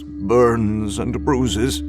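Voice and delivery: usual speaking voice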